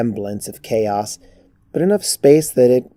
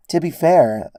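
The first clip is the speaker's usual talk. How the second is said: usual speaking voice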